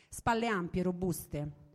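plain reading voice

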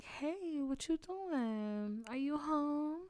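high, slow coy flirt; coy high call to an ex; flirty text. Retold or imitated. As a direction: make it a sweet booty call. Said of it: "and be like".

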